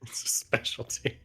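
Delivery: breathy quiet repeat; quiet aside. Acted. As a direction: murmur it under breath. Echoing the line softly.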